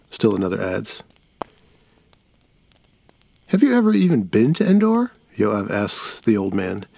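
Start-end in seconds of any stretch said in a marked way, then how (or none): 3.5-5.1 much higher, fast, challenging; a doubting kid calling his bluff; boy voice. Acted. Yoav challenges old man.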